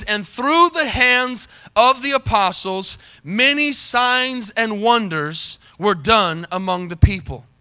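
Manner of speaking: slow, deliberate scripture reading; measured pulpit reading, drawn out; reading kinda slow. Real, not acted. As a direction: read it out slowly, phrase by phrase. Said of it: "read them kinda slow".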